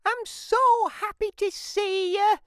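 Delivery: very high, loud doting mum voice; mum's cry of joy. Acted. Mum greeting Jim with a hug, very high and loud. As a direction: squeal it like a mother thrilled to see her son.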